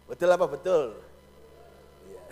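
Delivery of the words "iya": usual speaking voice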